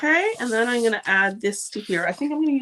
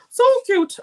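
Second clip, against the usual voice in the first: very high, loud squeal; squealing cute; 11 dB louder than usual. Real, not acted. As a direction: squeal it like you can't stand how cute. Gushing over the cactus card.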